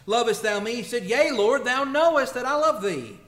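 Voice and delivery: loud, high, pressing question and answer; fervent plea. Acted. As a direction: build to an impassioned cry. Peter's plea voiced in sermon.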